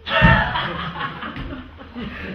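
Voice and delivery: loose, open laugh; burst of laughter. Real, not acted. The pranksters laugh at Malvolio's exit. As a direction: let the laugh spill out.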